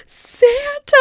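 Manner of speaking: imitated little girl's very high, amazed cry; squeaky, awestruck excitement. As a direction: squeal it like an astonished four-year-old. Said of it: "she was so excited".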